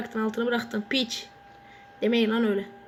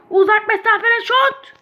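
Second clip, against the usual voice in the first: excited call; 7 semitones higher, 8 dB louder than usual. Real, not acted. Announcing long-range shot challenge.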